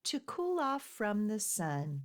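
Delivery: put-on soft, even rhyme reading for children; poem reading. A narrator's reading. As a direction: read the verses gently to little listeners. Reads penguin poem aloud.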